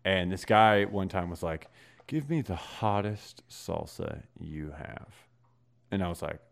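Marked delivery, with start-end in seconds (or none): 2.1-5.1 slow, softer, deliberate order; lightly voiced tough customer, drawn out; retold demanding customer. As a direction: imitate a customer laying down a challenge.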